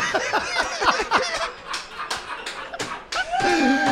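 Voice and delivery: long high shrieking laugh; howling laugh. Real, not acted. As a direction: howl with laughter. Howling at the story.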